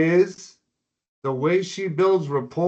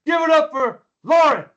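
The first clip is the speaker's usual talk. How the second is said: high, loud crowd hype; hype announcer call; 12 semitones higher, 5 dB louder than usual. Real, not acted. Mentor announcing Lauren to the audience, loud hype call. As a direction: call out the guest like an emcee.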